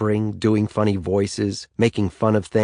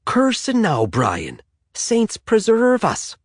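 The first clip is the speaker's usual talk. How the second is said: high, slow Irish brogue mimicking Seamus; Irish accent; 13 semitones higher, slower than usual. Brother teasing Brian after he swears, Irish accent. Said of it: "mimicking Seamus's Irish accent to a T".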